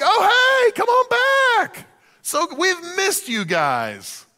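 high, slow, hammed-up sarcastic welcome; mock welcome. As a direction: sing out a fake friendly greeting. Egyptians voiced welcoming them back.

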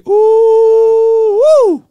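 high, loud whooping "Ooh, woo!"; excited whoop. Real, not acted. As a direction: let out a big excited whoop. Recalling punching walls, hyped.

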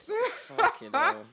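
laughing. Real, not acted. Laughing disbelief.

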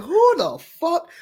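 high-pitched exasperated disbelief; worked-up indignant questioning; upset, incredulous. Acted. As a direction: stretch it out in outraged bafflement. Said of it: "I'm more upset about this".

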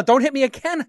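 put-on high, pleading kid voice; acted cowering fear. Scared kid to the man who hit him, acted high frightened plea. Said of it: "the kid cowers in fear".

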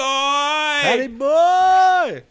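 very high, loud, stretched-out call; hammed-up holler, dragging out the last word; drawn-out mock holler. Friend echoing Logan's greeting to Pat, drawn-out shout.

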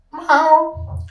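high, far-off cat meow; a tiny high miaow. Acted. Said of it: "sage ich und krieche".

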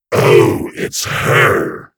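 startled loud stammer. A narrator's reading. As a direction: blurt it out in sudden alarm. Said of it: "he stammered".

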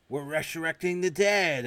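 high, loud, slow put-on proclamation; mad scientist voice.

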